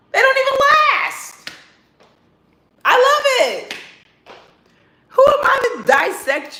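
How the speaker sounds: high, loud gloating squeal; gleeful squeal. Real, not acted. To viewers about rival girls, a high gleeful squeal.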